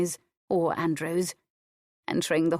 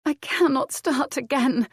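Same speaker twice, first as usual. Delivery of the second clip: high, small broken plea; exhausted widow whimpering, voice high; 8 semitones higher, 3 dB louder than usual. Acted. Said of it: "Adair whimpered".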